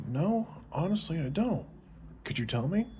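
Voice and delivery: put-on high, earnest girl's voice; Susie, a little girl, answers Johnny innocently. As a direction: pitch up into a young girl, innocent and honest.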